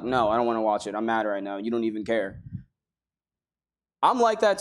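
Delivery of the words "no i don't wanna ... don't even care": low, sulky grumble, trailing softer; a pouting child refusing to look. Retold or imitated. Speaker to God, sulking in the cave: low sulky grumble.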